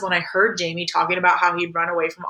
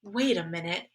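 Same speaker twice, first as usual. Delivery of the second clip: softer, measured, suddenly serious; getting serious; 8 dB quieter than usual. A narrator's reading. Mom stops her young son short, serious. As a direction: drop the volume and turn serious. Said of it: "getting serious".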